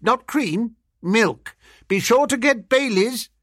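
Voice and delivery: high, chattering busybody voice; high-pitched, breezy instructions; face at the window. A narrator's reading. Face giving the narrator grocery orders, high voice.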